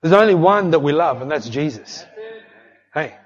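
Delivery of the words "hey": usual speaking voice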